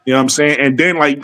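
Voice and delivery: normal talking voice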